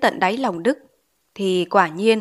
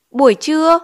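short query, higher and louder; pressing question; 7 semitones higher, 5 dB louder than usual. A narrator's reading. Teacher questions pupil about his noon hours, pressing. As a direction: throw out a raised, probing question.

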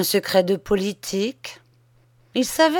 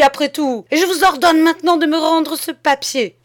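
loud, high, threatening anger; irate mayor pulling rank at full volume; angry outburst; 9 semitones higher, 8 dB louder than usual. A narrator's reading. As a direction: lay down the law in a furious raised voice. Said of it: "Un frisson de colère passa dans l'âme violente".

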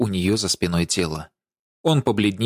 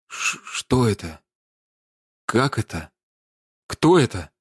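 stammered, halting questions in shock; struggling for voice. A narrator's reading. Man facing a corpse asks who it is, struggling for voice. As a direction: barely get the words out, with long pauses. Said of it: "с трудом справившись с голосом, проговорил".

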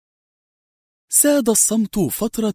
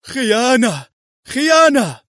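high cry building to a louder shout; dying last cry; 8 semitones higher, 4 dB louder, slower than usual. A narrator's reading. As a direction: cry betrayal with your last breath, the second time higher and louder. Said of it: "يهتف هتافه الأخير". (هتاف أخير يتصاعد إلى صرخة)

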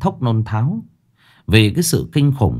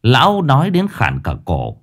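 plain reading voice

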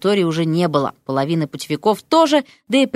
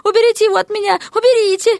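very high, shrieky plea; panicked squealing; 14 semitones higher, 4 dB louder, faster than usual. A narrator's reading. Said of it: "взвизгнула опешившая Мартина".

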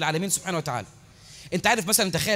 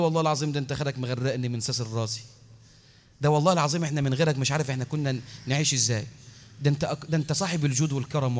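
fawning beggar; 6 semitones lower, 4 dB quieter than usual. Retold or imitated. Beggar flattering the rich merchant, low soft grovelling plea. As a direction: lower and softer, pleading flattery. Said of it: "يتمسكن".

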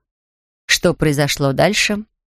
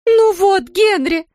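high, scolding outcry; exclaiming in reproach; 12 semitones higher, slower than usual. A narrator's reading. Wife blames husband for sinking bear, high exclamation. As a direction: cry out high, blaming him. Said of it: "воскликнула миссис Браун".

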